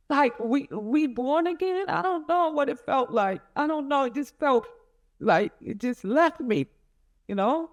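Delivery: reliving the shock, pitched well up; overcome with emotion. Real, not acted. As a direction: voice lifted high with emotion, on the edge of tears. Mother reliving hearing the news.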